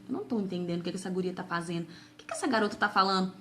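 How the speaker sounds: a hint of a bewildered dad muttering to himself; father's puzzled thoughts. Imagining what her father thought, lightly voiced bafflement. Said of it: "ficou com a cara de".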